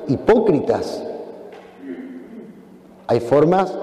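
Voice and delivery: normal talking voice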